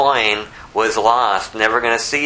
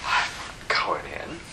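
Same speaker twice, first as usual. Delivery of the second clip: low whispered mutter; sulky whisper; 9 semitones lower, 9 dB quieter, slower than usual. Acted. Older son muttering to himself, whispered. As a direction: sulk under the breath.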